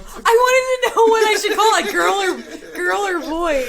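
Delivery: high, near-crying laughter; helpless laughter. Real, not acted. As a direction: explain through tearful giggles. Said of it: "when you guys are done".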